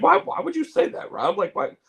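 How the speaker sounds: imitating a friend, high-pitched puzzled "why"; puzzled protest. Friend asks why he'd say that, puzzled protest. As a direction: jump up high, mock-baffled.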